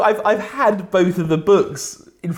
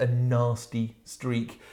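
normal talking voice

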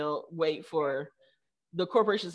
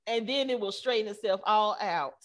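put-on Texas accent; 5 semitones higher than usual. Texan Googler reassuring the founder, in a hammed-up Texas accent. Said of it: "She got a real heavy Texas accent".

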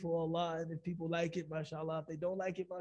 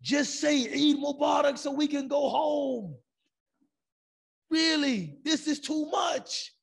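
loud, high, slow shout of an impatient uncle; retold angry shout; 8 semitones higher, 6 dB louder, slower than usual. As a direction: bellow it from the back of the hall, fed up. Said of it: "shouted from the back of the auditorium".

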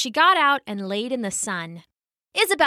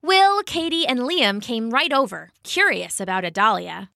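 usual speaking voice

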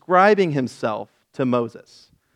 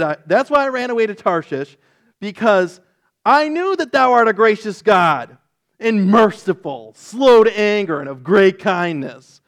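high, loud indignant protest; mad Jonah; 7 semitones higher, 5 dB louder than usual. Retold or imitated. Jonah protesting to God that He is too gracious, loud and high. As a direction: spit God's kindness back as an accusation. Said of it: "Like he's mad about these things".

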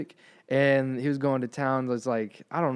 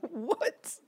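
shocked what; 14 semitones higher, 7 dB quieter than usual. Retold or imitated. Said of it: "And I'm like".

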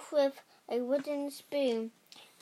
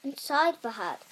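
plain reading voice

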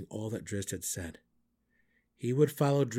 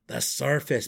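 loud, dismayed gnome mutter; muttered, almost groaning; 4 semitones higher, 8 dB louder than usual. A narrator's reading. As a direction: groan it out, appalled. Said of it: "Belwer muttered".

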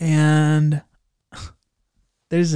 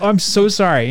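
flustered apology; 6 semitones higher, 6 dB louder than usual. Retold or imitated. Apologizing after walking in.

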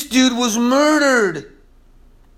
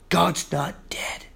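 soft low declaration; 4 semitones lower, 8 dB quieter than usual. Shown as a demonstration. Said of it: "Listen to the song".

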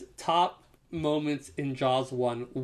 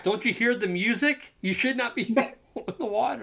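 mock yell back; 8 semitones higher than usual. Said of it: "you wanna yell back".

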